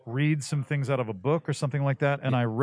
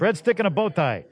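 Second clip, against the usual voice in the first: higher-pitched quoted TV character; Steve Buscemi; 7 semitones higher, 4 dB louder than usual. Retold or imitated. Quoting Boardwalk Empire about the stuffy coach, higher impression voice. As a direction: throw out the line as an impression, pitched up. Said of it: "what's that show with Steve Buscemi".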